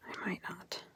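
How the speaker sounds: breathy unvoiced aside; whispered aside. Real, not acted. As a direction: whisper it to yourself. Muttered aside to self.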